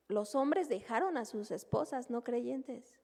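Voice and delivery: normal talking voice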